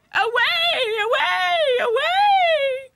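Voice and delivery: very high, loud repeated shriek; piercing hysterical storybook aunt; screamed. A narrator's reading. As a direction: shriek each one in a frenzy. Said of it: "screamed his aunt".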